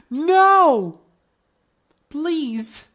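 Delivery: high loud playful cry of no; mock terror. Real, not acted. Streamer pleads with the art to stop, mock terror. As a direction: beg for mercy in jest. Scared of cute fan art.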